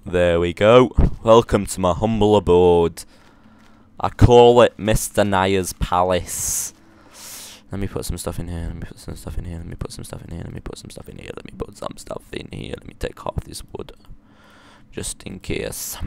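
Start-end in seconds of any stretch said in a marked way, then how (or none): 0.1-6.7 loud showy welcome; grand host. Pretend. 7.7-13.9 repeated, fading to a whisper; fading mutter. Real, not acted.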